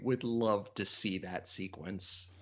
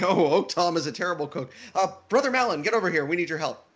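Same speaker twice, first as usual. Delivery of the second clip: voicing Tarquin; 7 semitones higher, 5 dB louder, faster than usual. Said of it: "And Tarquin's like".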